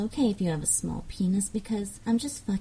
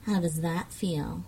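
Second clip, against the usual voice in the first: ordinary talk, nothing marked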